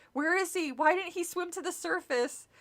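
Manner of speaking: frightened calls. Acted. Frightened kids calling out.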